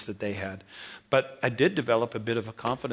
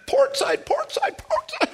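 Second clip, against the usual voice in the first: climbing panicked cries of port side; retold frantic steering orders; urgent high calls; 4 dB louder, slower than usual. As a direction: call the side desperately, higher each time. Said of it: "It's like".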